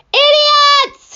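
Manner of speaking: very high, loud yelled insult; yelling. Acted. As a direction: hurl it across the sky. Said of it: "he yelled".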